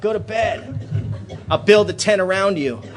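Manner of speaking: a fed-up father ordering his son into the tent; angry dad. Retold or imitated. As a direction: bark the kid off to bed. Father furious at the campsite.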